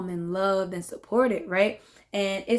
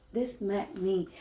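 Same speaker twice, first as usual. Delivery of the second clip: quiet emphasis; 7 dB quieter than usual. Real, not acted.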